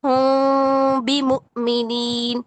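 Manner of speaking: ہوں بھی stretched slowly, meem hidden with nasal hum; teacher demonstrates ikhfa with a paper gap; paper-thin lip gap. Shown as a demonstration. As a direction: say ہوں بھی with a tiny gap between the lips. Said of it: "اتنا minor سا gap".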